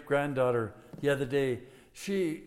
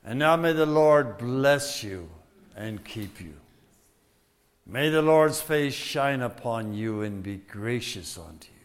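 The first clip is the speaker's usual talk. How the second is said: priestly blessing; 5 semitones lower, 7 dB louder than usual. Acted. Pastor blessing congregation with raised hands, solemn. Pronounces benediction.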